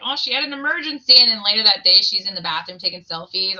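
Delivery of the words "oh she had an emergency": mock excuse. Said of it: "They're like".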